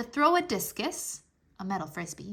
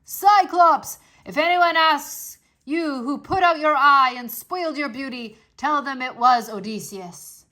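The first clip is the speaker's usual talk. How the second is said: shouting; 3 semitones higher, 7 dB louder than usual. A narrator's reading. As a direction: boast his name at the monster, loud. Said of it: "Odysseus shouted again".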